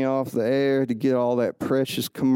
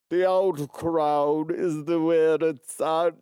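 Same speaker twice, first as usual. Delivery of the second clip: higher, drawn-out snooty put-on voice; stupid voice; 4 semitones higher than usual. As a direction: do a silly, slow, superior character. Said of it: "for me to do that stupid voice".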